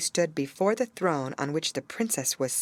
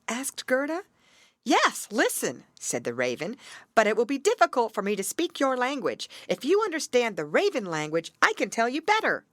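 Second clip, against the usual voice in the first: lightly higher raven voice; 7 semitones higher than usual. Acted. Raven explains to Gerda, in a lightly higher voice. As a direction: speak as the wise raven, a touch higher.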